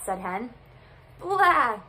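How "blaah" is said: high, loud bleat; goat bleat. A narrator's reading. Goat seeing the sun half gone, bleating loudly. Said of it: "bleated Goat".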